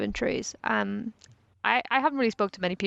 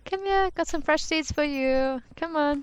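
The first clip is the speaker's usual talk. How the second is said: coaxing; 7 semitones higher, slower than usual. Acted. Feeding birds, coaxing them over.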